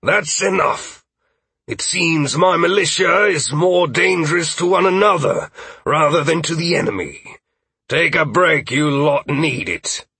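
exasperated drill master calling out; disgusted. Acted. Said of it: "disgust evident in his voice".

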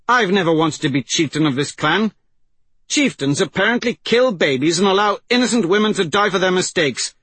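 high, loud, scathing reproach; indignant tirade. Acted. Persephone condemns cowardly chieftains, indignant and angry.

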